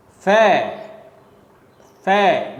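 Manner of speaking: "Fair" as a strong, isolated beat with a fall; stressed syllable. Shown as a demonstration. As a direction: stress each "Fair" on its own. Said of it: "the first syllable of this word".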